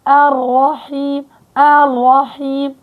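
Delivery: الرحيم slow, ra curled back. Retold or imitated. Teacher imitating the curled ra mistake. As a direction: curl the tongue on the ra of الرحيم. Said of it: "فبيلفوا لسانهم بالراء".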